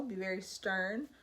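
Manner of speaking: usual speaking voice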